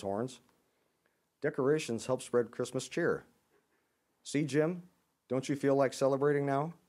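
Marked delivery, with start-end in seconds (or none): 1.4-6.7 higher, louder eager coaxing; bright coaxing. A narrator's reading. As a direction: coax a grumpy friend into cheer. Oxpecker cheering Jim up.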